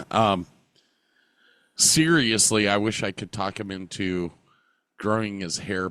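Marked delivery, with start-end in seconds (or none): none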